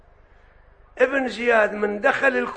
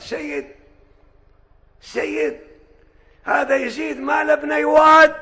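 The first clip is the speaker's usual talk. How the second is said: defiant shout; 7 semitones higher, 7 dB louder than usual. Retold or imitated. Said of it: "قال".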